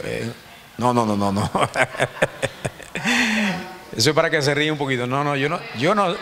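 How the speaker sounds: ordinary talk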